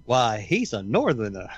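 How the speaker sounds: hammed-up Southern local; mock Southern accent. Southern locals sizing up a Yankee stranger, mock Southern accent. Playing locals spotting a Northerner.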